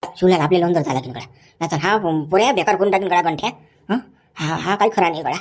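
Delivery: a hint of a gruff local; rustic villager. Second onlooker scolds Ganthan, lightly voiced rustic man.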